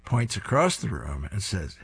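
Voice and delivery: ordinary delivery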